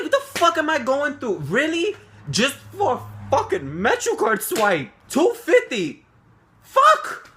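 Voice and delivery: high, loud, furious retold rant; mad outburst. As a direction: rant like you can't believe the unfairness. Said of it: "Yo, I was so mad".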